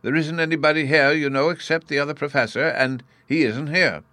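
a touch of a flustered professor; slightly frantic, high-pitched insistence; mildly wild. A narrator's reading. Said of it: "he added wildly".

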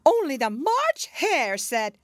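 high, fearful Hatter voice; nervously telling the king. A narrator's reading. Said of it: "the Hatter went on".

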